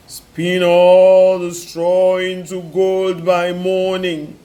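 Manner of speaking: slow, loud royal command; a slow, weighty king's command; stern king. A narrator's reading. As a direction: order her like a stern king. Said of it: "said the king".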